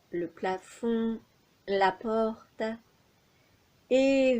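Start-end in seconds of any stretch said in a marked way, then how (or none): none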